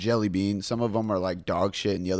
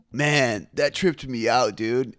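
stoner voice; 3 semitones higher, 7 dB louder, much slower than usual. Retold or imitated. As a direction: drawl it like a stoned dude.

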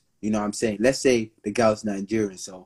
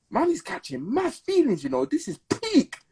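quoting a smitten guy; 13 semitones higher than usual. Retold or imitated. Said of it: "they're like, Raw".

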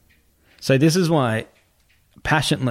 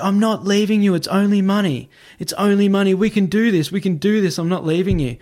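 high, urgent pleading, retold without sobs; retold bawling; 8 semitones higher than usual. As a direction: imitate a distraught girlfriend begging. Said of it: "She's bawling".

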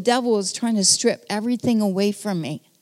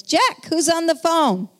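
yelling across the house; 8 semitones higher than usual. Retold or imitated. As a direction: call out to your husband from another room, pitched high. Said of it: "She yells".

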